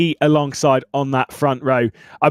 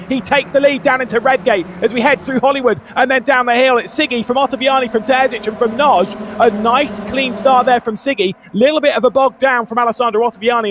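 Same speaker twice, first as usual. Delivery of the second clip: raised, energetic race play-by-play; motorsport commentator; 9 semitones higher than usual. Acted. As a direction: call the race start live and excited.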